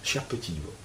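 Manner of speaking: hushed, gentle asking; soft inner question. Shown as a demonstration. Teacher to students, asking the inner voice: hushed and gentle. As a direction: ask your inner voice softly, almost to yourself. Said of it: "vous demandez à votre petite voix".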